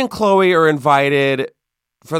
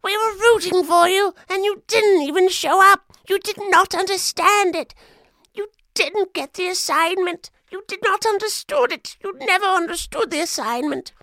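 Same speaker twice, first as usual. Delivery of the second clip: much higher mock-outraged activist voice; Greta Thunberg impression. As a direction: scold like a furious young activist. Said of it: "I'm sure Greta Thunberg was like".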